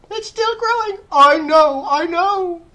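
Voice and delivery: very high, loud shrieks of glee; excited shouting. A narrator's reading. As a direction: shriek back and forth in excitement.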